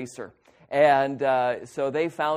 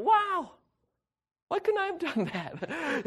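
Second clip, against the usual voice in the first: much higher, laughing wow of awe; amazed wow; 4 dB quieter than usual. Retold or imitated. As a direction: marvel at him through a laugh. Amazed at one-whack kill.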